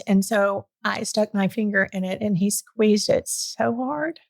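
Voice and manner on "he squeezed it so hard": real choked-up finish, fading on the last word; emotional. Real, not acted. Mother recalling her newborn squeezing her finger, emotional. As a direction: voice rising and thinning at the end. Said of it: "I still get emotional about it".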